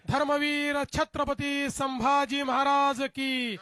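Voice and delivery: slow, drawn-out shouted slogan chant; roaring rallying speech. Real, not acted. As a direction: call out each slogan slowly for the crowd. Rally crowd call-and-response slogans.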